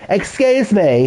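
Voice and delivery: lightly voiced prim little man; imitating the father. Streamer to chat, mocking the father's voice. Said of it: "this is how he talks as well".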